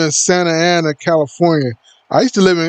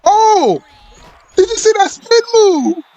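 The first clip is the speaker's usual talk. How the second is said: high squeaky excited announcer; shrill, high hype-man voice; character voice. Acted. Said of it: "that's just my character voice".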